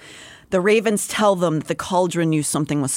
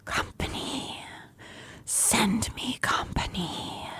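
soft echoing dream voice; 5 semitones higher, 12 dB quieter, slower than usual. Acted. The creature in the dark.

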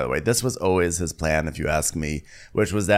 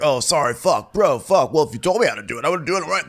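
higher, louder, lightly voiced excuse; a hint of a sulky bro; defensive deckhand; 8 semitones higher, 4 dB louder, faster than usual. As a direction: whine your way out of the blame. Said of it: "He's like".

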